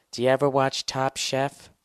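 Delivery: perky put-on girl asking; girlfriend voice. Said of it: "girlfriend asks in scene".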